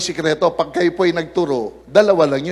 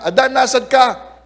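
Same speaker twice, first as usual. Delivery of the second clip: God calling Adam; 5 semitones higher, 6 dB louder than usual. Acted. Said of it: "Sabi niya".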